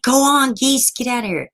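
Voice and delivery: high, urgent retold holler; high-pitched scolding shoo; shooing geese.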